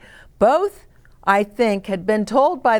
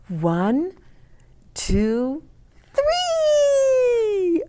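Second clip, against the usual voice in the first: excited countdown; 13 semitones higher, much slower than usual. A narrator's reading. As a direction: stretch it up high like a launch. Counting down to swoop in.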